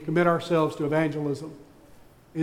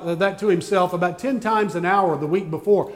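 normal talking voice

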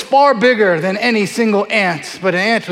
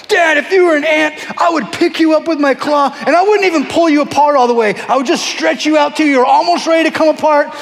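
put-on kid trash talk; 5 semitones higher than usual. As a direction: brag like a five-year-old picking a fight. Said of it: "starts talking so much trash".

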